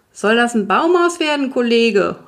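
high-pitched neighbour's holler; calling out. A narrator's reading. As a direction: shout across the fence to dad. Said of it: "rief der Nachbar".

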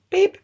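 high bleep sound; censor beep. Pretend. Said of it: "Wow, ich sollte nicht fluchen".

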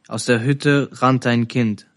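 ordinary delivery

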